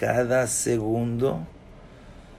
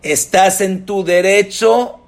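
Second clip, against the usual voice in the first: high, loud, insistent reassurance; emphatic validation; 10 semitones higher, 10 dB louder than usual. Acted. Rabbis telling the hurt woman she is in the right, emphatic and loud. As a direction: back the listener up with rising force.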